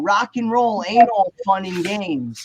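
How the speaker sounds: usual speaking voice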